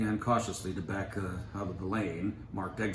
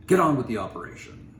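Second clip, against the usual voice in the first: much louder command; commanding surgeon; 6 semitones higher, 12 dB louder than usual. A narrator's reading. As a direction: take charge of the room.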